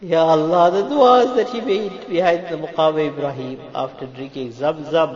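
high, loud impassioned supplication; tearful plea. Real, not acted. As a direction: cry out to God with force. Cries out in prayer.

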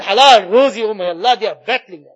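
ordinary talk, nothing marked

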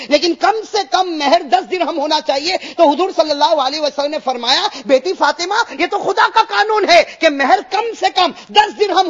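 high, loud fervent sermon; impassioned preaching. Acted. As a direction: build it up and proclaim. Preaching the dowry ruling. (بلند آواز میں پرجوش خطابت)

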